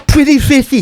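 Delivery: very high, loud sing-song call; imitating brother again. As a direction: squeal it out like a kid calling a fish. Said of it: "retelling the call".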